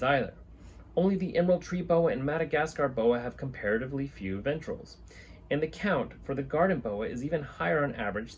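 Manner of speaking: put-on reading an old herpetology text to the camera; reading aloud. Reads a scientific book aloud.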